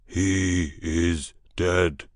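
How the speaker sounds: very slow, heavy beast voice; slow creature voice. Acted. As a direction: drag each word like a beast. Grey thing answers.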